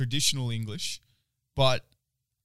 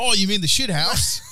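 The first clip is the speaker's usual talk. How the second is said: high, louder, broad mock-Aussie voice; country Aussie local; 8 semitones higher, 5 dB louder than usual. Local answers a stranger seeking a bathroom, hammed-up country Aussie. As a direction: ham up a rural Aussie yokel. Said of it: "I was in country Australia".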